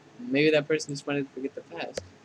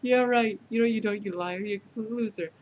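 high-pitched mocking dismissal; scornful, higher-pitched put-down; jealous scorn; 6 semitones higher than usual. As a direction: sneer it in a raised voice. Jealous character scorns the player.

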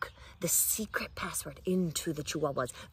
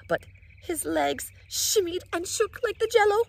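shaky fear; 12 semitones higher, 4 dB louder than usual. A narrator's reading. Narration shaking with fear.

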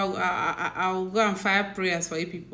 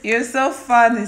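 laughing; 7 dB louder than usual. Real, not acted. Laughing at viewers.

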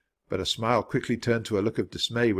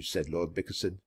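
plain reading voice

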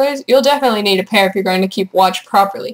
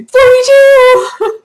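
drawn-out high shout of forty-two; excited shout; 14 semitones higher, 8 dB louder, much slower than usual. Real, not acted. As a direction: belt it out, long and high. Gleeful Hitchhiker's reference shout.

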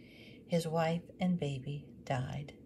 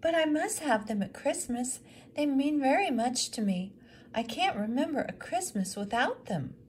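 much higher and louder, insistent; pleading widow; 9 semitones higher, 5 dB louder than usual. Acted. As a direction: plead that they matter so much. Widow pleading about Christmas.

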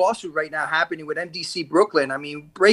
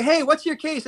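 casual inmate voice; 7 semitones higher than usual. Retold or imitated. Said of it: "he's like".

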